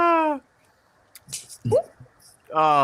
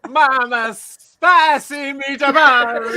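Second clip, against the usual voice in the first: slow hammed-up Italian, louder; mock Italian accent; 8 semitones higher, 5 dB louder, much slower than usual. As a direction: savor each word like an Italian chef. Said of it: "I can't do an Italian accent".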